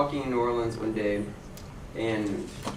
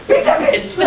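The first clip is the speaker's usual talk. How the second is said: very high, loud yelled "Beat that bitch!"; hammed-up yell; shout; 9 dB louder than usual. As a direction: scream it full speed. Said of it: "running at you full face".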